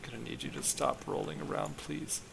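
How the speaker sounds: soft, hushed request; under your breath, politely; quiet polite plea. Pretend. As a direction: ask it nicely, very softly. Pleading with a rolling object.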